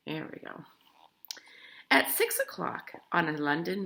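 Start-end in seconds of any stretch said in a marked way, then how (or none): 0.1-0.6 whispered aside. Real, not acted. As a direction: breathe it to the listeners.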